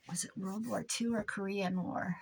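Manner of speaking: unvoiced whispered aside; whispering. Real, not acted. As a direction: whisper it to yourself. Muttered aside to herself.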